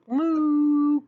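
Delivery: loud drawn-out moo; cow moo. Acted. Teacher voicing the cows with a long loud moo.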